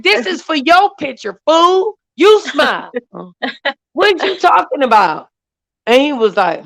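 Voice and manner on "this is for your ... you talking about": exasperated mom. Retold or imitated. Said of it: "And I'm like".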